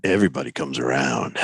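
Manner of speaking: very low, sinister promise; ominous. Pretend. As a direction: deliver it like a movie villain. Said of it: "Do that again. Just say it like that".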